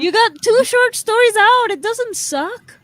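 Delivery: high, loud disbelieving objection; loud protest. Real, not acted. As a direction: argue back hard, cheering him up. Friend objects his year sucked.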